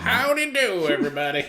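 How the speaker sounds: put-on high, loud tinny old-timey greeting; old radio voice. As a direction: sound like an old mono speaker. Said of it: "Like he was talking through ... mono speaker".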